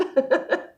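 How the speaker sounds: high unvoiced giggle; breathy giggle. Real, not acted. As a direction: giggle at yourself. Laughing at yawns.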